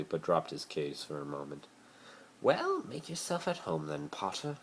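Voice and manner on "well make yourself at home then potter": high, cool drawling welcome; Draco's voice. A narrator's reading. Draco tells Potter to make himself at home, cool. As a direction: say it with a cool aristocratic air.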